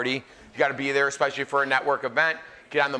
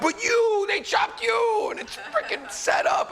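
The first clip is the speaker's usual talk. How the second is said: furious yell; slower than usual. Retold or imitated. Telling mom after being chopped, high furious shout. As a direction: yell it at mom like the show was rigged. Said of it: "I was so mad".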